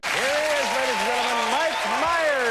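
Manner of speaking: high, ringing host announcement; announcer intro. Real, not acted. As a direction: call the guest on like a showman. Host introduces guest, calling out.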